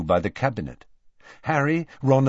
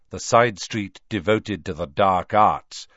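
ordinary delivery

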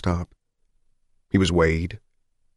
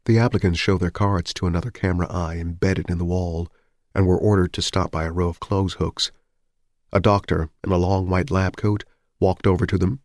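ordinary delivery, nothing marked

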